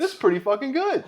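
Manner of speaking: high, loud impressed firefighter. Retold or imitated. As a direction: praise the joke, delighted. Firefighter quoted.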